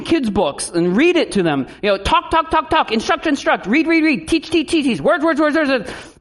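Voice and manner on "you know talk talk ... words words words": higher, rattling repetition; mocking list. Mocking parents who only talk, higher rattled list.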